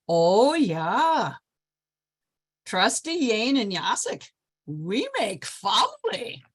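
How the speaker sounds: put-on proudly mangle the idiom in a Polish accent. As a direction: Polish guest announcing the friendship, slow and accented. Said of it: "talking like this".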